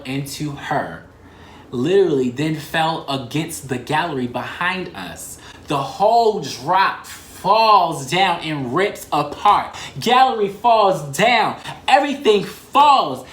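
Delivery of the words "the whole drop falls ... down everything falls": slow, louder and louder, rising high; recounting the crash, getting louder; dramatic build-up. Real, not acted. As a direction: tell the collapse like a disaster building. Dramatic retelling of the fall.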